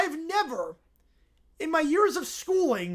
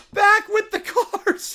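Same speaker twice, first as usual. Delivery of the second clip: excited; 7 semitones higher, 7 dB louder, slower than usual. Real, not acted. Repeats announcement, drawn out.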